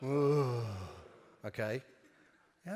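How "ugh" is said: a drawn-out, disgusted groan at a bad joke; lightly acted groan of disgust; demo groan. Shown as a demonstration. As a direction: show the audience how to groan. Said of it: "you have to have a practice groan".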